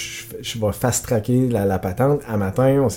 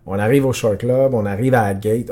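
usual speaking voice

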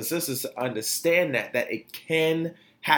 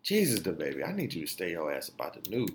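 exasperated mutter; 7 semitones lower, 8 dB quieter, faster than usual. Real, not acted. Exasperated aside to [name].

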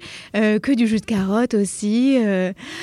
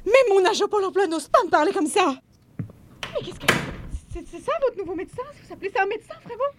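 high, offended voice; outraged patient storming off; indignant outburst; 10 semitones higher than usual. Acted. Patient outraged at the rude doctor, high indignant voice.

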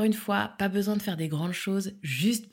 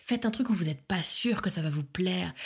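ordinary delivery

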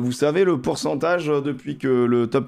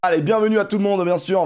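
upbeat welcome, raised pitch; show host intro; 7 semitones higher than usual. Acted.

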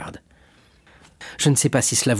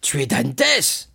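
put-on drunken outcry; 5 semitones higher, much slower than usual. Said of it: "laissé tomber sa tête sur la table".

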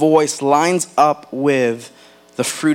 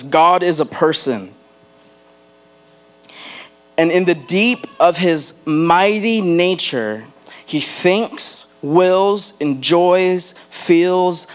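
higher, emphatic sermon reading, stretched on key words; emphatic quote reading; 3 semitones higher than usual. Acted. Preacher reading a Tozer quote to the church, emphatic. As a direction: read it out with weight, listing each verb separately.

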